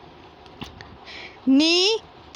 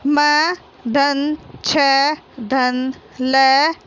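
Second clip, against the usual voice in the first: slow, letter-by-letter म धन छ धन ल धन ई; teacher slowly assembling मछली; slow spelling out; much slower than usual. Shown as a demonstration. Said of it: "तो क्या हो गया".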